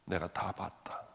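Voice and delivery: soft, low, grave utterance; a low hush, almost under breath; hushed voice of the Lord. Retold or imitated. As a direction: let the words sink in quietly. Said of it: "몽학 선생은".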